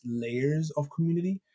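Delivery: usual speaking voice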